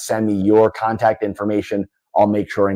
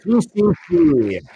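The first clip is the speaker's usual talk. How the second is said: hyped giveaway announcement; 9 semitones higher, slower than usual. Real, not acted.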